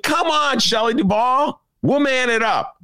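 high, taunting urging; sarcastic pep talk to a movie character; mock exasperation. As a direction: egg the heroine on, teasing. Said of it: "I'm like".

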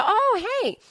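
high-pitched "oh, hey" of recognition; mock-bright jump up in pitch; retold surprised greeting. Comic retelling a star introduction, surprised greeting.

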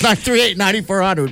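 phone number called out high; high mock plea. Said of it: "repeat of the number, raised".

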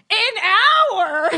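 high, loud mock shriek; hammed-up incredulous shout; parents' shocked outcry. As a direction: imitate two parents appalled at once. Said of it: "they both in unison go".

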